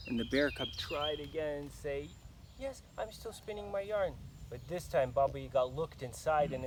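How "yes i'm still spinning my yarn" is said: higher, lightly voiced girlish reply; girl's voice. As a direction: cub faking the girl. Cub fakes girl's voice again.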